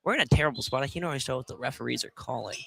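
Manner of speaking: ordinary delivery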